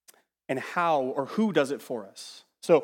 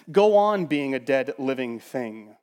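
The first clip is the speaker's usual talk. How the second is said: solemn command; 6 dB louder, slower than usual. Retold or imitated. Preacher voicing Paul's command.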